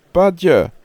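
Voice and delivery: loud high call across the field. Acted. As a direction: shout for the badger. Robin calls out again.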